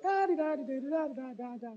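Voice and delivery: high, soft babbling; playful high nonsense babble; mock wife chatter. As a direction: mimic endless chatter. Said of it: "she's like".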